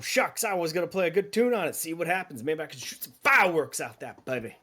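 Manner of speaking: playful cook bragging a little; a hint of the jolly cook. Cook voiced by GM.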